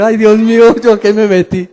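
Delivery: panicked woman. Retold or imitated. Lady in the roller coaster queue, loud panicked cry. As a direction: cry out like a frightened woman in line. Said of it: "una señora, que dice".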